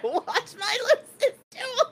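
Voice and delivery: high laughing, near tears; laughing hard. Real, not acted. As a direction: crack up mid-line.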